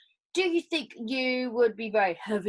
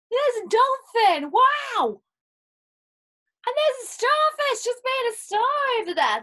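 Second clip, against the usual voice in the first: much higher, louder, thrilled; excited wonder; 12 semitones higher, 4 dB louder than usual. Acted. Teacher showing children sea creatures, much higher and excited. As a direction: squeal with delight at the dolphin.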